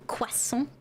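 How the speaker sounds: croissant with a French kwa-sohn sound. Shown as a demonstration. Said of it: "all Frenchly".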